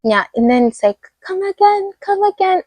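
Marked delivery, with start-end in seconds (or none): 1.3-2.6 high-pitched mock querying; imitating a confused listener; mimicked puzzled listener. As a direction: pitch it up high like someone not catching it. Mimics people asking her to repeat.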